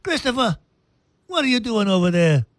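high mock New York Italian call; New York accent. Host performing his New York Italian accent for Esther. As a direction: shout across the room in a put-on New York Italian voice. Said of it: "So a New York accent".

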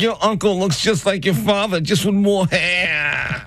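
high mom voice, slowing at the end; mocking mom. High mom voice telling the kids about the dog. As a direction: play mom, high, drag the last words. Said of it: "she's like".

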